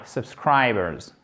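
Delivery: Subscribers, slowly, SCRI rhyming with eye; stressed vowel as I. Shown as a demonstration. Teacher shows the stressed vowel, slow Subscribers with long eye sound. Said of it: "Comment on prononce la voyelle?".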